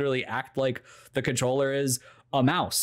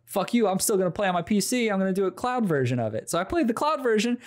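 animated high-pitched retelling; 8 semitones higher than usual. Real, not acted. Building frustration retelling tech fails.

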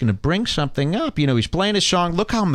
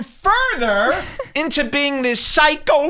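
laughing. Real, not acted. Laughing rising pitch.

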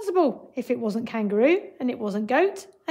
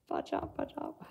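whispered; 11 dB quieter than usual. Pretend. Voicing animals squeezing in.

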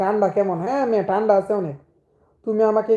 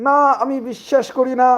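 dramatic disbelief; 4 semitones higher than usual. Acted. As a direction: throw out the denial like a TikTok drama scene. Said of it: "সেই reaction". (নাটকীয় অবিশ্বাসে চিৎকার)

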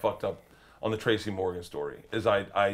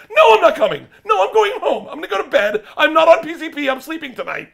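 high, loud, worked-up protest; frantic refusal; 12 dB louder than usual. Retold or imitated. Comic replays telling his friend he's leaving, frantic refusal. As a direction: shout it like someone who has had enough.

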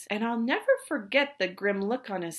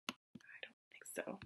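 whisper aside; 25 dB quieter than usual. Real, not acted. Reader aside to the children, whispered. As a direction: breathe it as a secret. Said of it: "aside to listeners".

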